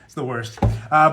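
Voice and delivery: ordinary talk, nothing marked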